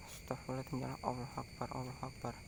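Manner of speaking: hushed, breathy muttered prayer; whispered prayer. Real, not acted. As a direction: murmur it under your breath, scared. Frightened prayer after spirit call.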